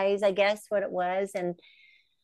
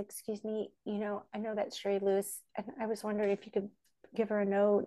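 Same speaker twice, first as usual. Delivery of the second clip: hushed, soft nervous asking; timid request; 9 dB quieter, faster than usual. Retold or imitated. Fan quietly asks the stewardess, hushed and nervous. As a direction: ask quietly, scared to bother anyone.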